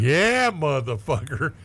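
slow, loud, punched out; triumphant gotcha. Retold or imitated. Detective gloating at the caught suspect, slow and loud.

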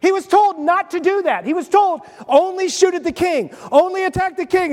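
preacher amazed at the irony; excited. Real, not acted. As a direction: push it up high with disbelief. Excited retelling of orders.

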